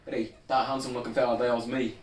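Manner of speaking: put-on loud, high Young Offenders Irish; Irish accent. Mimic getting into the accent, loud Irish. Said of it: "get into that accent".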